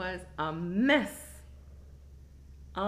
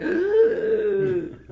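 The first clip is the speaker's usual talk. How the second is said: sharp high groan of pain, louder; 10 semitones higher, 10 dB louder than usual. Retold or imitated. Telling friends how much it hurt, a pained groan. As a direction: cry out as if it really hurts. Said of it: "this shit hurt".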